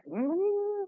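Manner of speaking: high rising "mm"; long, squeaky upward hum of effort; strained hum. Acted. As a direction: let a hum climb sharply in pitch. Mimics feeling wobbly or strained.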